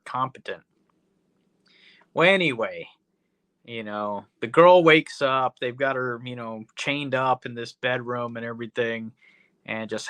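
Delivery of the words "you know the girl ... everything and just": usual speaking voice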